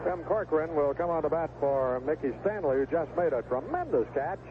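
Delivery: ordinary talk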